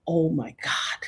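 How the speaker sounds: stunned, whispered disbelief; hushed, voiceless astonishment; awed whisper. Retold or imitated. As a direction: whisper it in awe. Stunned reaction retold.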